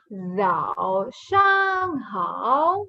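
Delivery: "Zao shang hao" very slow, each tone drawn out; slow model pronunciation. Shown as a demonstration. Teacher models good morning, very slow "Zao shang hao". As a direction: stretch every syllable of "Zao shang hao". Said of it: "So we say Zhao Shang Hao".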